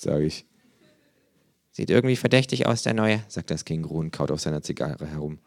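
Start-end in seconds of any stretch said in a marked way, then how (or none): 1.7-3.2 kangaroo voice. Acted. Känguru voiced by narrator.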